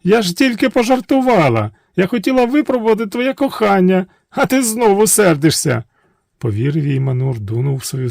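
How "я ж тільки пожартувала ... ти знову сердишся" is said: high sweet princess voice; high coaxing princess; coy princess. Acted. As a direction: wheedle him, all innocence. Princess character voice.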